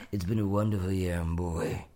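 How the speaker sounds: put-on low, husky drawl with boy dropping way down; Kathleen Turner impression. Imitating Kathleen Turner flubbing Broadway at the Tonys. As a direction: do her slurry Tony Awards line. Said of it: "She meant to say Broadway".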